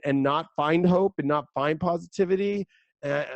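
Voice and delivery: normal talking voice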